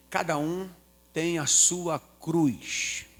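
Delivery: drawn out, lingering long on the last words; grave, slow answer. Retold or imitated. Mother answering her suffering child, grave and slow. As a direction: let each phrase sink in slowly.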